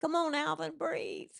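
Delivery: high, loud plea to a dying chipmunk; urgent coaxing. Pretend. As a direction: urge it on like a frantic rescuer. Reviving a chipmunk.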